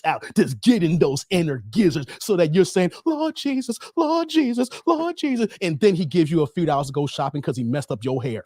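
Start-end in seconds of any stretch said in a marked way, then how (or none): 3.0-5.5 high, hammed-up cries of Lord Jesus; mock ecstatic cries. Mocking a satisfied woman to his viewers, high hammed-up cries. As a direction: ham up a woman crying out in bed, pitched way up.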